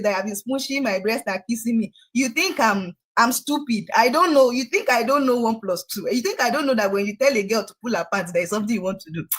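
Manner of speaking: ordinary talk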